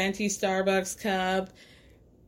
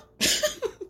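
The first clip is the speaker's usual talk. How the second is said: unvoiced giggle, much higher; high breathless laugh. Real, not acted. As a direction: laugh in disbelief. Laughing at a parent's remark.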